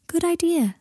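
high, light character voice; voiced Luna. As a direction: pitch it well up for Luna's line. Luna voiced in narrated story.

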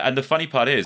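ordinary talk, nothing marked

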